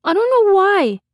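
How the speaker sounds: put-on high, loud child whine; baffled small child protesting; aggrieved little boy. A narrator's reading.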